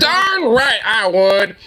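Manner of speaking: slow, prim mock of DeSantis's tame answer; a light sneering echo of the candidate. Streamer mocks the candidate's tame "darn", lightly prim. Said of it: "Why don't you just say".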